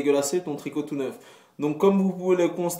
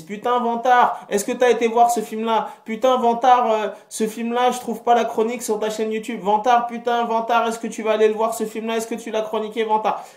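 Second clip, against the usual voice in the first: pestering fan voice; 8 semitones higher, 4 dB louder than usual. Retold or imitated. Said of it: "les gens me disent".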